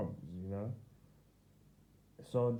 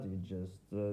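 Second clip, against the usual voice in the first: normal talking voice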